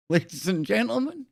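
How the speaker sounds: lightly voiced news anchor rant; a hint of a ranting TV anchor; Howard Beale style. Streamer mimicking Howard Beale for his viewers, lightly voiced. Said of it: "Old Howard Beale style network".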